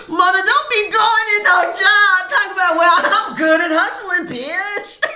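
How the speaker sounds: high, loud words through laughter; laughing. Real, not acted. Reader teasing viewer Mona while laughing loudly. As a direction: shout it while cracking up.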